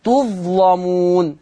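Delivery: تظلمون with a heavy, dark l before the a; تظلمون with Warsh's velarized l, vowel backed; thickened lam. Shown as a demonstration. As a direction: slowly say تظلمون, making the lam full-mouthed. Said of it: "فلورش التغليظ".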